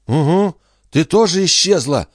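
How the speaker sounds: put-on high indignant boy retort. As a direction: answer back, offended and high. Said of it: "возмущённо ответил Пин".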